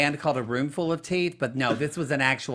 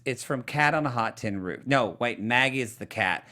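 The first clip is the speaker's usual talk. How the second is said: normal talking voice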